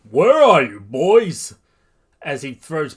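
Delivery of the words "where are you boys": loud, high searching shout; booming call. A narrator's reading. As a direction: call out across the city for them. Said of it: "All Might is running around saying".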